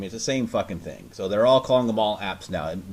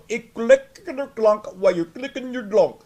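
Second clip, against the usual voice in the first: high put-on foreign voice, slower; mock tech support agent; 11 semitones higher, 5 dB louder, slower than usual. Mocking the internal tech support guy, high put-on voice. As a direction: parody a scripted helpdesk worker. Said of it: "But if they're going".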